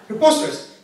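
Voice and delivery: hammed-up announcer imitation; public service announcement. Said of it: "he performed the public service announcement".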